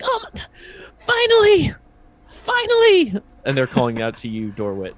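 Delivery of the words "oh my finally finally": tiny creature, extremely high voice; high-pitched kobold. Acted. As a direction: squeal it loudly on "finally". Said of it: "very high-pitched voice goes".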